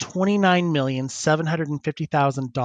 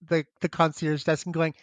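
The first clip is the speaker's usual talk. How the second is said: ordinary talk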